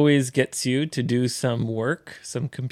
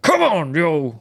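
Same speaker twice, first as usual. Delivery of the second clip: raised, rallying call; pep-talk shout; 4 semitones higher, 3 dB louder than usual. Pretend. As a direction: urge them on loudly. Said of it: "喝を入れてほしい". (気合いを入れて励ます)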